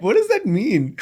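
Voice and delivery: high laughing question; laughing disbelief. Real, not acted. Laughing incredulous reaction.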